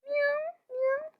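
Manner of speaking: very high-pitched pretend meow; cat voice. Kid showing their cat sound for an animal-noises video, cat voice. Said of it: "este es mi voz de gato".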